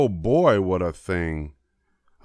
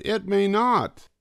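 much higher, stress on never; worried Sally; 12 semitones higher than usual. A narrator's reading. Sally fretting about mother's dress, much higher. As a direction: fret it, little girl voice. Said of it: "Sally said".

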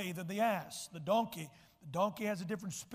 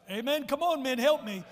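loud, raised call to the men; 4 semitones higher, 9 dB louder than usual. Real, not acted. Preacher rousing the men in the pews, loud and raised. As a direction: stir up the men.

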